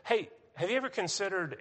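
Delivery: lightly voicing his wife's eager idea; quoting his wife. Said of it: "and she said".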